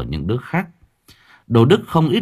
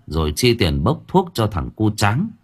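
ordinary delivery

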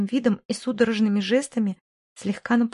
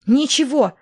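higher, louder sudden cry; a sharp, raised outburst; shrieked; 4 semitones higher, 6 dB louder, slower than usual. A narrator's reading. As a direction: snap back in a brief shriek. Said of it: "взвизгнула Марина".

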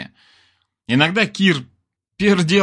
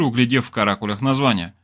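ordinary delivery

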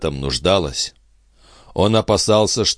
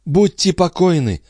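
reassuring exclamation; 12 semitones higher, 3 dB louder than usual. Acted. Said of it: "отвечал Андре".

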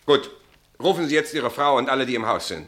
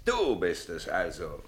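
low, slow and soft, almost to himself; cold quiet; 8 semitones lower, 9 dB quieter, slower than usual. Acted. Captain to the suspect, slow and low. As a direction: size him up quietly before the storm.

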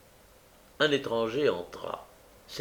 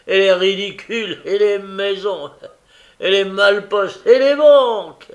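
louder and higher with each item, peaking on banques; building beery brag; 7 semitones higher, 11 dB louder than usual. A narrator's reading. Noé échauffé par la bière énumère ses vols, de plus en plus fort. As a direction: pile up the list, getting carried away. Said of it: "que la bière échauffait".

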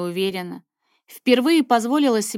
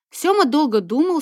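usual speaking voice

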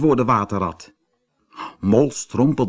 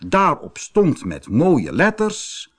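ordinary delivery, nothing marked

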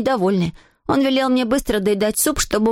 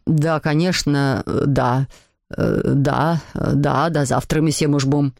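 low, halting apologetic dad; meek, abashed father; 8 semitones lower than usual. A narrator's reading. Papa realises his boss is calling, low halting meekness. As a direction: stumble submissively through apologies to a boss. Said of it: "тихоньку сказал".

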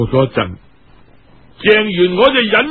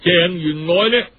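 usual speaking voice

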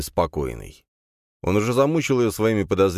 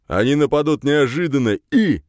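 loud, high beastly voice; growling creature; 8 semitones higher, 5 dB louder than usual. A narrator's reading. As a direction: push the idea like a brute. Rin keeps proposing.

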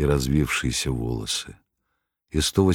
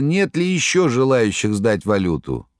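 ordinary delivery, nothing marked

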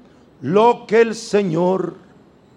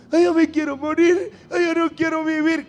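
higher, wailing lament, lightly acted; mock weeping mother; 7 semitones higher, faster than usual. Preacher imitating a depressed, crying mother, higher mock wail. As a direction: play the mother's despair as a half-serious whimper.

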